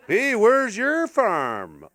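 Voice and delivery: slow, drawn-out country holler; mock farm worker. Farm worker questioning a landowner, slow hammed-up rural voice. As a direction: call out slowly as a farmhand.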